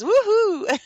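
high, loud whoop of delight; gleeful whoop. Real, not acted. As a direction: let out a high, celebratory cheer. Gleeful cheer over magic and miracles.

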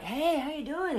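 high, bright mock American hello; breezy mock-American "hey"; chirpy American greeting. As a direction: imitate an eager American greeter.

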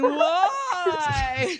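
mock crying whine. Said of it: "women calling you crying saying".

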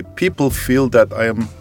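ordinary talk, nothing marked

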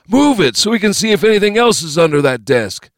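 high, loud thug voice; loud, high-pitched goon giving orders; bossy first gunman. Acted. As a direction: order your partner about in a high voice.

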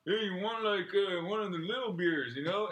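high, lightly voiced dad offering tiny beers; imitating girlfriend's dad. Comedian imitating the dad's small beer offer, lightly voiced. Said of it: "That's how he sounds".

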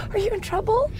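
hushed, very high worried question; scared friend. Retold or imitated. Imitating an intimidated friend on the phone, high and hushed. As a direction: ask it small and high, fearfully. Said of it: "intimidated or scared of my dad's hello".

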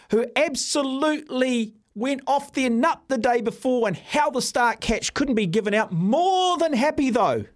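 high-pitched, incredulous outrage; indignant rant. Real, not acted. Host ranting at former Australian cricketers' double standard, indignant and high. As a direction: pitch leaps up on the key words in exasperation.

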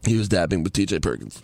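lightly voiced echo of a friend; imitating Andrew. Echoing his friend's line in a light imitation. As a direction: mimic the friend's line back at him. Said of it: "that was a very good impression".

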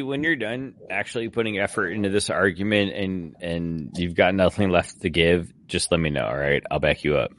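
very low, soft, level delivery; low deadpan. Real, not acted. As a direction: drop way down and offer it deadpan. Wry offer to back arguer up.

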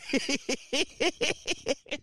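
a soft, high giggle to himself; high soft laugh. Real, not acted. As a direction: chuckle quietly at your own joke. Laugh after teasing listeners.

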